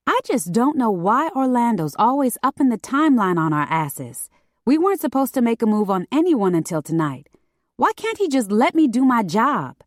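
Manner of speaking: high-pitched complaint; exasperated griping. A narrator's reading. Paris complains about the moved timeline.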